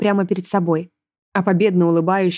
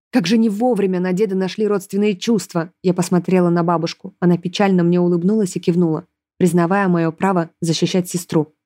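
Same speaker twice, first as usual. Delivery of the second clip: ordinary delivery, nothing marked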